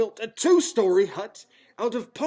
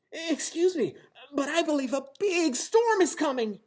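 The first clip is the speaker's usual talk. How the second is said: polite little bunny; 10 semitones higher than usual. Acted. Henry politely warns.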